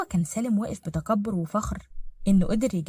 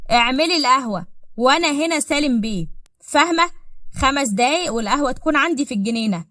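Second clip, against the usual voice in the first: raised, louder imperious order; domineering command; 4 semitones higher, 5 dB louder than usual. Acted. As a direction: put the servant in her place. Hausherr kommandiert die Dienerin herum.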